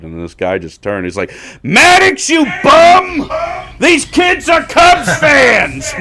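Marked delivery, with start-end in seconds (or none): 1.6-5.9 mock old heckler yelling, much higher and louder; furious heckler shout. Old fan heckling Maddux in the outfield, a hammed-up furious yell. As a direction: bellow it at the pitcher, slowing on the last words. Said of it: "I haven't seen somebody this mad".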